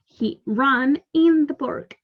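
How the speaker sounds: slow model reading. Shown as a demonstration. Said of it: "teacher models the sentence slowly".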